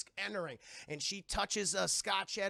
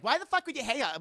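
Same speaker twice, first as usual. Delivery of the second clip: louder, higher retold outburst; mock angry demand; 6 semitones higher, 9 dB louder, faster than usual. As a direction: demand it, acting indignant.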